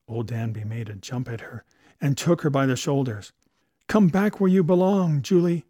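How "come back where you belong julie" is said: loud, high pleading cry; a man crying out to his lost love; cried out. A narrator's reading. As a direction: call her back with everything you have. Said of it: "he cried".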